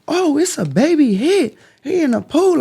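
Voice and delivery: high, slow mock lady voice; neighbor lady. To her friend on the phone, a high mock lady voice.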